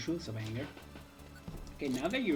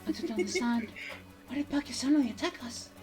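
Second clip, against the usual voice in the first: higher-pitched, shocked hero; bewildered game character; 10 semitones higher than usual. Acted. Hero checking on Jimmy after the attack, bewildered.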